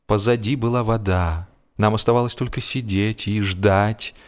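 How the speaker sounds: ordinary delivery, nothing marked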